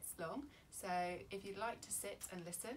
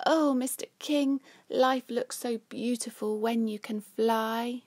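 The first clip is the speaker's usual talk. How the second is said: higher, louder dreamy reply; happy sigh; 5 semitones higher, 19 dB louder, slower than usual. Acted. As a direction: sigh it out with a smile. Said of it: "replies with a smile and a sigh".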